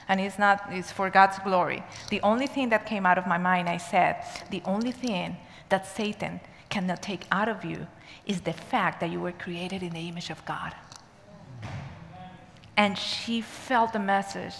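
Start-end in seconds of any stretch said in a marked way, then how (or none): 4.5-10.7 near-whispered, low and gentle; hushed reassurance. Retold or imitated. Teacher telling a prisoner she is made in God's image, hushed. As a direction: drop to a soft, breath-only hush. Retells gentle answer to troubled woman.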